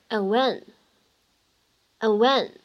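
"and when" slowly with the d of "and" left unreleased; incomplete plosion. Shown as a demonstration. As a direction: swallow the d in "and when". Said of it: "不完全爆破的处理". (不完全爆破读 and when)

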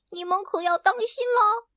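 high little hen threatening; a tiny hen trying to sound scary; mock fierce hen. Hen Hena jumping at the ducks, high mock threat. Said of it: "大声叫道".

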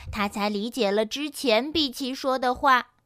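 ordinary delivery

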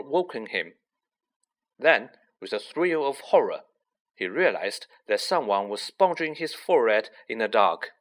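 plain reading voice

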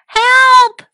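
high, loud desperate call; trapped tiger screaming for help; cry for help. Acted. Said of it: "cried Tiger".

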